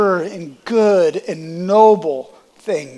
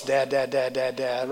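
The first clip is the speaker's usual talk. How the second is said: put-on father coaching baby; 6 semitones lower, 8 dB quieter than usual. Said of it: "all night I'll do this".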